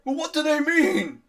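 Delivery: high, loud, bewildered shout; anguished father's yell; distraught demand. Acted. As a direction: cry out for an explanation. Distraught father questions the party.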